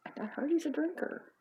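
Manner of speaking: high-pitched repeat of the rumour; high, insistent gossiping wife. Acted. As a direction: pitch it up, rising at the end.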